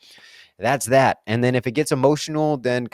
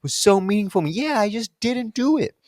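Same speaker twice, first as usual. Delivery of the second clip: much higher self-mocking sing-song; mock sheepish self; 10 semitones higher than usual. At day's end, a much higher mock confession. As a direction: admit the failure in a silly put-on voice. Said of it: "I'm like".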